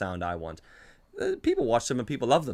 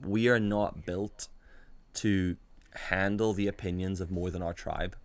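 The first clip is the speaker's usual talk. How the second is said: slow, weighty quote; slower than usual. Shown as a demonstration. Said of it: "think about this sentence for a minute".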